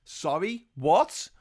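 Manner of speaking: usual speaking voice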